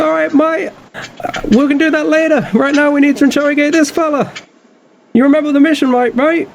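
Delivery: high brash cockney captain voice; cockney pirate captain. Acted. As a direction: bark it like a cocky pirate boss.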